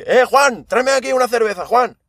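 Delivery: high, brash customer calling the barman; hammed-up rude regular ordering a beer; mocking rude customer. Friend mocking a rude customer's high bossy order.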